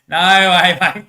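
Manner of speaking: loud, high cry of disbelief; loud, incredulous outburst; laughing disbelief. Real, not acted. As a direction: exclaim it on the edge of laughter. Laughing disbelief at imagined scene.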